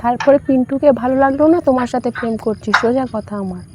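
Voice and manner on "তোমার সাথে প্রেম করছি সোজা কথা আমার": normal talking voice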